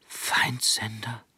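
slow, fearful whisper. A narrator's reading. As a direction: breathe it out in awe. Boy hears enemy radio.

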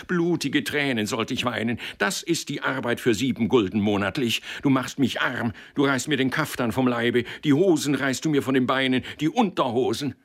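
high, building rant of outraged self-pity; agitated lament. A narrator's reading. Boss lamenting what his clerk costs him, agitated. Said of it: "Der erregte Mann".

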